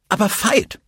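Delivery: high, pleading call of his name; reproachful plea. A narrator's reading. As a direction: pull him back with a sharp high plea. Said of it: "versuchte, ihn wieder einzufangen".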